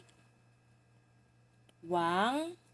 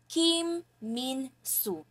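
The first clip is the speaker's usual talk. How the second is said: slow; 2 semitones higher, much slower than usual. Shown as a demonstration. Slow model of the name.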